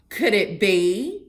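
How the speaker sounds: loud gleeful wonder; thrilled at the thought. Acted. Gibbles spotting her pickle, loud and gleeful. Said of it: "says Gibbles with glee".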